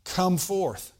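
high, loud summons to Lazarus; ringing preacher's acted command; loud commanding cry. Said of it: "he cried with a loud voice".

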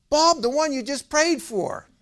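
high, loud, ecstatic phone voice; imitated hysterical friend; hysterical shouting. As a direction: shout it like an overexcited caller. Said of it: "he's shouting and screaming".

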